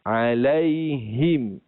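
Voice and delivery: alaihim stretched slowly, ending in him; slow alaihim recitation. Shown as a demonstration. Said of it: "yang tepatnya adalah alaihim".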